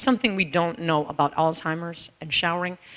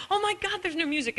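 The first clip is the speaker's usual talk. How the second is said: high, softer panicky imitation of mother; panicked mother; 11 semitones higher, 7 dB quieter than usual. Mother noticing music stopped, high mock panic. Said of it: "my mother's like".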